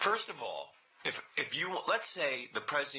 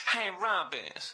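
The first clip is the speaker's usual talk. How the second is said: slow drawn-out street greeting, hammed up; 6 semitones higher, much slower than usual. Caller planning a fake persona greets Robin in a slow put-on voice. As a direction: stretch the greeting out in a mock-cool voice. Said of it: "I was gonna talk like this".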